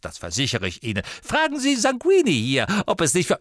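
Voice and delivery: eager sales pitch, often high and pressing; businesslike biographer. A narrator's reading. Warple offering to write Harry's biography, businesslike and eager. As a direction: pitch it briskly in a put-on, pushy voice. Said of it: "Es wurde plötzlich geschäftsmäßig".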